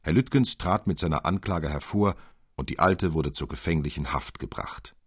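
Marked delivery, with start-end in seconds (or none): none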